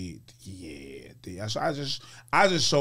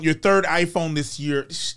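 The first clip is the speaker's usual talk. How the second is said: usual speaking voice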